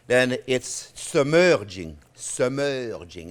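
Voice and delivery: "submerging" drawn out slowly, each syllable stretched; slow teaching word. Shown as a demonstration. Chef teaching viewers a new cooking term, slow and deliberate. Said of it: "That's a new culinary term we're learning today".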